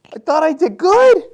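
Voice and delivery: mock panic at being called in; startled worry. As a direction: squeak it out like you're in trouble. Fearing Mike's sit-down is bad news.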